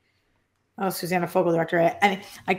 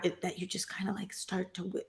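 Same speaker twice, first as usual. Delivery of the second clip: dropping toward whisper; 11 dB quieter than usual. Shown as a demonstration. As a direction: voice sinks away to very soft. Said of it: "a lot of a whisper voice".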